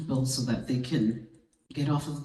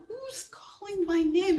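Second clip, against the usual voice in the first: puzzled; 13 semitones higher than usual. Retold or imitated. Puzzled at hearing her name.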